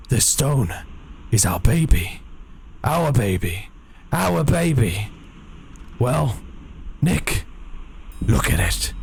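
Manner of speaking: put-on character voice, words parceled out with long pauses; Nick Stranger. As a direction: voice a strange man, halting phrase by phrase. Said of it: "this is Nick Stranger's voice".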